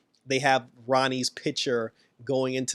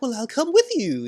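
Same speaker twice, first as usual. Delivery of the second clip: eager offer; 11 semitones higher, 4 dB louder than usual. Retold or imitated. Eager Rita tags along.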